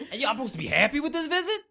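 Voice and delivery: loud, high mock indignation; indignant kid. Kid challenging absent dad about the visit, indignant.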